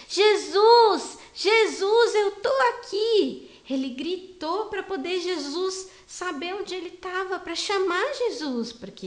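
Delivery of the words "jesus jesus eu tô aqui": loud, high call to someone out of sight; shouting. Acted. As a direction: cry out so he can find you. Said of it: "Ele gritou pra Jesus".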